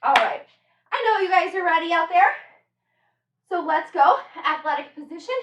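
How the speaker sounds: ordinary delivery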